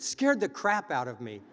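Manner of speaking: normal talking voice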